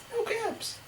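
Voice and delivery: high, small voice of dismay; puzzled discovery. A narrator's reading. Peddler looking round for his caps, puzzled high voice. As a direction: pitch it right up, as if finding nothing.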